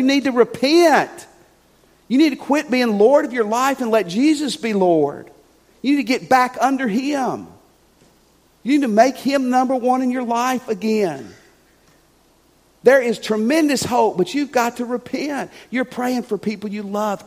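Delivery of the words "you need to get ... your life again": ordinary talk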